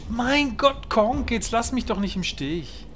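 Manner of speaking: normal talking voice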